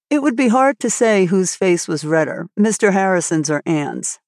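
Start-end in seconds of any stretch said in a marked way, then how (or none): none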